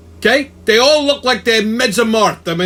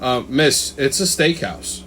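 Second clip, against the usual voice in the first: deadpan waiter; 6 semitones lower, 4 dB quieter, slower than usual. Retold or imitated. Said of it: "looks at her and goes".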